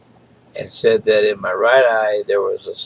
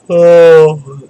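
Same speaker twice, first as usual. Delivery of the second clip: a loud yawn on oh; 6 semitones higher, 11 dB louder than usual. Real, not acted. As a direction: stretch out a yawn. Yawns, then apologizes for yawning.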